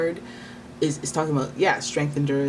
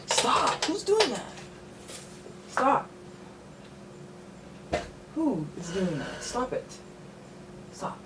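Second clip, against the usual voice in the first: scolding a pet; 9 semitones higher, 5 dB quieter, slower than usual. Real, not acted. Scolding her cat mid-reading.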